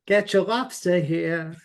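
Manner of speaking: put-on slow Maine accent vendor call. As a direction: hawk lobster in a Maine accent. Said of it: "only if they sing it in a Maine accent".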